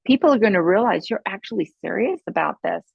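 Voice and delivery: ordinary delivery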